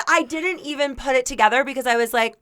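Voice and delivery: ordinary talk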